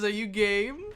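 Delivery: a hint of a smug professor; professor dwarf voice. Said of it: "And he says".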